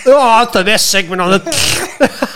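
slow, high mock announcer voice, laughing; laughing imitation of the host intro. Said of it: "I could hear it, like".

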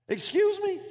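high incredulous excuse me; mock-shocked retort; indignant. Real, not acted. Preacher again cuts in, mock-shocked and indignant. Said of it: "indignant rhetorical retort".

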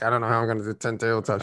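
fast anxious thinking aloud; panicked inner voice. Retold or imitated. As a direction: rush it like private panic. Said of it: "but in my mind, I'm like".

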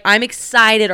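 ordinary delivery

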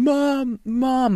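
high mock sobbing call; imitated crying. Son crying to his mom, imitated crying. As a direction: imitate being too choked up to speak. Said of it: "keeps crying and saying".

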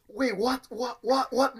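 high, confused repeated what; baffled student. Retold or imitated. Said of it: "I was like".

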